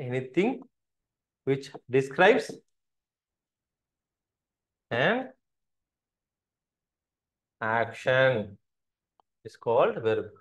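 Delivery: very slow, long gaps before "an" and "action"; halting pace, as if writing along; word-by-word dictation. Shown as a demonstration. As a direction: dictate it in small pieces for note-taking. Dictates the definition in pieces.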